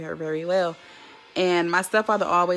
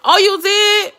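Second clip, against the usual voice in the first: high and loud, caught off guard; surprised; 13 semitones higher, 12 dB louder, slower than usual. Real, not acted. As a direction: react like startled news. Surprised by a viewer's comment.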